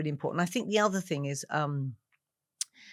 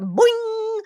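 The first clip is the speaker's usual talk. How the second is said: comic boing; 10 dB louder than usual. Retold or imitated. Red hair popping out.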